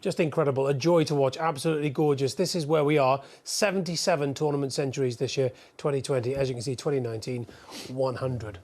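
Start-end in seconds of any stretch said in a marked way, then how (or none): 0.0-7.5 excited. Real, not acted. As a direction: rave about it, thrilled. Pundit raving about the break.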